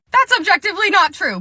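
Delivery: high, loud protest; defensive employee. Acted. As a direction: push back in a high phone voice. Employee defends herself to customer.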